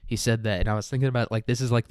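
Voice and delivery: ordinary talk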